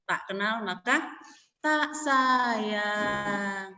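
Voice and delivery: slow, measured proverb recital; lightly recited proverb. Shown as a demonstration. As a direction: recite the saying slowly, like a lesson. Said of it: "ada pepatah dalam bahasa Indonesia".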